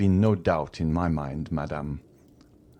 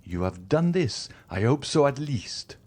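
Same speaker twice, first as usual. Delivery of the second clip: increasing excitement; 8 semitones higher than usual. A narrator's reading. Magistrate accuses his poisoner wife, high excited tirade. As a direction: build the accusation into a fevered rant. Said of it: "with increasing excitement".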